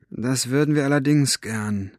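ordinary delivery

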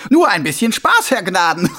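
high, loud mocking voice; a smug killer playing innocent; sneering villain. A narrator's reading. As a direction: Carcer mocking the watchman. Karzer mocks.

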